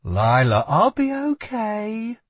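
tightly held reassurance, rising high and slowing; strained, high "I'm fine". A narrator's reading. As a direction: say it tightly, drawing out the end. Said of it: "she said tightly".

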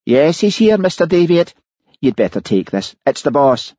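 voicing the wife on the recording; Mary's voice. A narrator's reading. Mary voiced in narration.

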